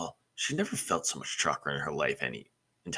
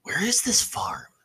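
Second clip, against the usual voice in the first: strained with effort; 4 dB louder, slower than usual. Acted. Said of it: "Naruto grunted in exertion".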